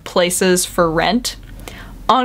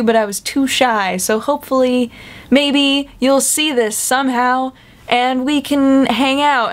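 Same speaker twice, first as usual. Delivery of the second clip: shy sweet poster; 6 semitones higher than usual. Retold or imitated. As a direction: play the timid admirer.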